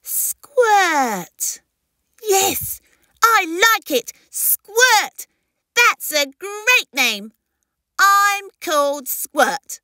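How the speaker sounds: very high, excited little creature voice; delighted hatchling. Acted. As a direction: try out the new name with glee. Baby delighted with new name.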